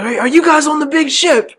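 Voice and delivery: very high mock London accent; put-on London accent. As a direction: pitch it way up as an imitated Londoner. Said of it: "You gotta hit him with the accent".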